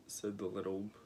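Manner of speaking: ordinary delivery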